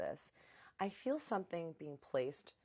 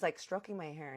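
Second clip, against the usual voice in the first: normal talking voice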